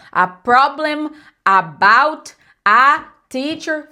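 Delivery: "a problem, about, a teacher" loud and chopped, every "a" a full AH; over-stressed, Portuguese-style "a problem, about, a teacher"; choppy, no schwas. Shown as a demonstration. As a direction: punch each word of "a problem, about, a teacher" separately. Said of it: "fica picado e não fica fluido".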